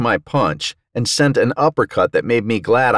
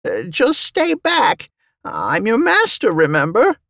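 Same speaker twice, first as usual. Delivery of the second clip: intimidated djinn. A narrator's reading. Djinn to his threatening slave, nervous pleading. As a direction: back off nervously in a high voice. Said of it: "what lay behind my threatening eyes was too much for him".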